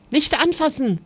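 high, quick warning without shouting; warning call. A narrator's reading. Mother warning her children off the pot, a high warning call. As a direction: call out to stop their hands. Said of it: "rief Mama".

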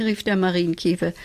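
plain reading voice

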